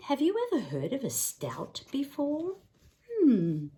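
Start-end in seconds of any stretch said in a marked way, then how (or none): none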